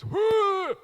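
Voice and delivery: very high, loud furious grunt; dad's favourite grunt. Retold or imitated. Italian dad's favourite noise, grunted loudly. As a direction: let out a wordless bark. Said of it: "My dad's favourite was".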